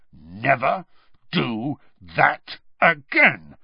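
NEVER, DO, THAT, AGAIN each separate; word-by-word warning. A narrator's reading. Moody bounces the ferret, "Never do that again" word by word. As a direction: slowly punch out "Never do that again", pausing between words. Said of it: "speaking each word as the ferret hit".

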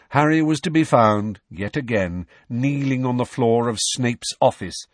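usual speaking voice

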